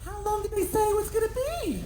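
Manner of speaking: mimicking the wife. Wife interrogating about pizza.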